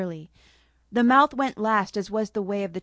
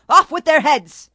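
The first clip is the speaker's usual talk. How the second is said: much higher, very loud triumphant shout; battle cry; 7 semitones higher, 11 dB louder than usual. Acted. Ruby rallies her sister and the monkey with a loud cry. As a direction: bellow it like a queen's decree. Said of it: "she commanded".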